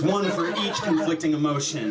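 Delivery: ordinary delivery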